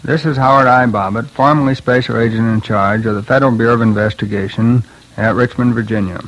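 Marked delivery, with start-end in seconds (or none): none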